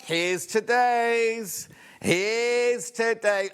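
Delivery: long, lifted ta-da announcement; drawn-out reveal. Real, not acted. Presenter revealing today's decoration, slow and drawn out. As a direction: stretch each word out high and slow.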